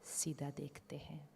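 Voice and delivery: very soft, unhurried meditation voice; guided meditation. Real, not acted. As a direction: settle them quietly, with long pauses.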